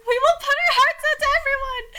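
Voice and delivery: high, loud hammed-up cry; overblown declaration. A narrator's reading. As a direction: belt it out high and sustained. Reader voices Elon's overblown declaration.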